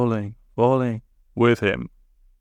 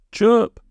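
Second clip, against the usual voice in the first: high loud bird chirp; playful chirp; 12 semitones higher, 5 dB louder than usual. A narrator's reading. Friday chirping just for fun, high and loud. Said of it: "just because he felt like it".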